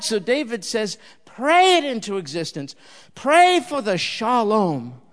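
high, loud impassioned plea; fervent preaching. Acted. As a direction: cry it out to the crowd. Preaching at full force.

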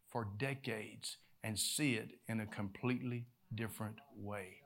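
low, soft storytelling with long pauses; measured, hushed sermon storytelling; hushed preaching narration. Acted. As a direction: draw listeners quietly into the Bible story.

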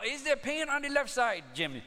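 stately phony physician greeting his patient; put-on doctor voice. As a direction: play a smooth prank-call doctor with a stage accent. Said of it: "this is Doctor Tang".

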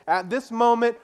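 ordinary talk

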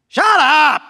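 high, loud crowd rebuke; yelling. Acted. Crowd silencing the blind beggar, yelling. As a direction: yell it to silence someone. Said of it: "many of the people yelled at him".

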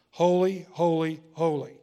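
loud, ringing "Holy, holy holy" called out; proclaimed seraph song. Acted. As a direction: cry out "Holy" like angels in worship. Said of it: "Now here's the song of the seraphs".

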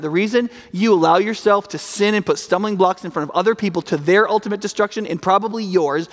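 ordinary talk, nothing marked

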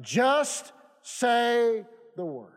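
JUST and SAY stretched very long; slow, emphatic. Shown as a demonstration. Preacher quoting the soldier's plea to the Lord, slow and emphatic. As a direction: draw out each word, ending on a falling "the word". Preacher stressing the key verse.

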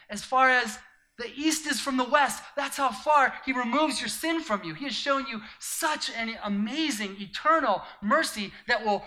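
loud, high, fervent preaching; full-force preaching. Acted. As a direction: preach it out to the crowd with fire.

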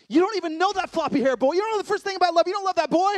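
loud, high-pitched exasperated rant; hammed-up fatherly outburst, building without a break; ranting dad tirade. Dad's imagined lecture to lovesick daughter, loud high rant. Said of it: "what I almost launched into".

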